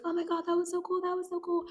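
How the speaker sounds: high breathless fangirl rush; excited teen gush. Retold or imitated. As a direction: squeal it like a thrilled teenager. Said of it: "Rue's like".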